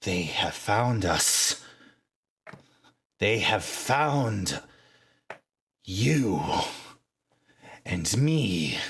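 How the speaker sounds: lightly voiced woman warning of doom; slow, hushed dread. Character to the party, slow fearful warning. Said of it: "and she says".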